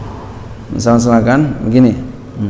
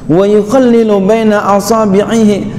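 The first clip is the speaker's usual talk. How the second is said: kitab recitation; 7 semitones higher than usual. Acted. Reads Arabic kitab text.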